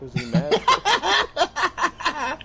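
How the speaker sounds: very high, loud, slow laughing question; squealing through laughter at the absurdity; laughing disbelief. Real, not acted. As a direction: stretch it out while cracking up. Laughing at the angry train.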